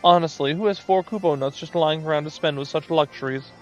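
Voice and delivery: high, gleeful scheming moogle. Acted. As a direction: rejoice over a bargain. Greedy moogle rejoices.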